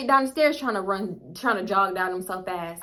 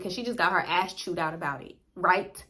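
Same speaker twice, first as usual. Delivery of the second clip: ordinary delivery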